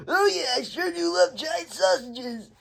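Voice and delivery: high, hammed-up silly voice; a cartoon worker, way up high; squeaky mock-innocent voice; goofy coworker. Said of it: "another guy over there is like".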